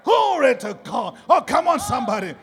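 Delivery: normal talking voice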